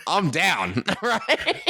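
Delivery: laughing through the words. Real, not acted. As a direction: giggle while you say it. Joking about unknown lyrics.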